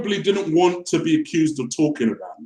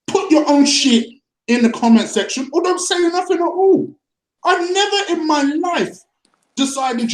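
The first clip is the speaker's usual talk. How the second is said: angry outburst; 7 semitones higher than usual. Real, not acted. Angry rant about criticism.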